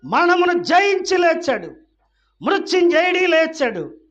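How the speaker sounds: high, loud preacher's shout; full-force pulpit cry; triumphant proclamation. Real, not acted. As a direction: shout the victory to the rafters. Triumphant climax of resurrection point.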